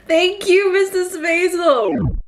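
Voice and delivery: tearful thank-you to Mrs. Maisel. Real, not acted. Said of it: "I'm crying here".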